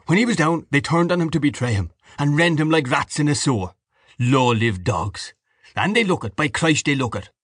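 heated, contemptuous rant; angry Mr Dedalus. Acted.

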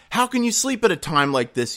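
the captain calls him out; captain's shout. A narrator's reading. Captain rousing sleeping Jonah, a mild mock shout. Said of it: "he shouted".

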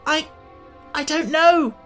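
high, loud, halting reply; pained. Acted. Tip answers his friends while in agony, pained. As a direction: stammer the answer out through pain. Tip still suffering fearful pains.

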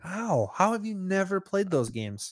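a high, disbelieving how; incredulous. Real, not acted. Friend can't believe he never owned a Game Boy, incredulous.

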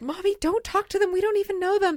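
high-pitched mortified child imitation; embarrassed kid. Daughter stopping mom approaching strangers, high imitated embarrassed kid. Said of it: "And she's like".

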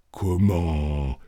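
low, understated amazement; murmured disbelief; astonished exclamation. A narrator's reading. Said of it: "s'écria-t-il". (stupéfaction contenue)

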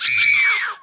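very high shrieked scream; mock girl scream. Imitating the girl who screams at her name, high shriek. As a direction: let out a shrill girlish shriek.

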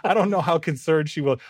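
usual speaking voice

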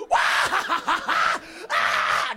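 wild high cackling shriek; choking laughter. Retold or imitated. Young men piling on, screaming with laughter.

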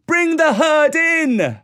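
high, drawn-out herding call; loud cry. A narrator's reading. Mowgli orders Akela to bring the herd, crying out. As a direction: holler the order, stretching each word. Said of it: "he cried".

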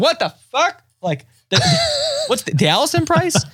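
replaying a shocked squeal at the TV; mock shocked outburst. Retelling yelling at the TV over the price, shocked high yelp. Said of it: "I scream at the television".

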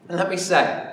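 ordinary delivery

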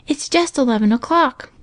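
put-on high child's voice; little girl. A narrator's reading. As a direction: pipe it in a high girlish voice. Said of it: "she continued".

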